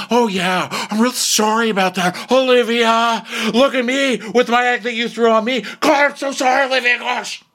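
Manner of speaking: high, loud whiny sarcasm; mock sarcastic apology. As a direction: throw the apology back in a hammed-up whine. Acted sarcastic apology in quarrel.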